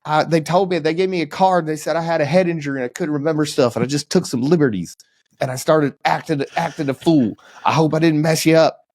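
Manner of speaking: high, put-on Southern voice; hammed-up Southern apology, pitched high; Southern accent. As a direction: play a sheepish role player in mock Southern. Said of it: "still have a Southern accent".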